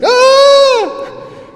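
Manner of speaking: very high, full-volume mimicked scream; answering scream. Roommate woken by his friend's scream, screaming back. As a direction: imitate a startled roommate's long scream. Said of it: "and he screamed".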